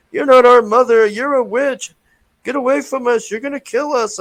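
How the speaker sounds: put-on high, loud childish voices yelling back; mock scared kids. Kids screaming at the witch mother, high and loud. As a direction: squeal it like terrified kids. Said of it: "these two kids are like".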